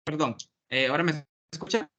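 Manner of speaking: choppy, cutting-out audio; bad connection. Real, not acted. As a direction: voice breaking up over a bad line. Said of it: "Entrecortado, pero se le escucha". (conexión entrecortada)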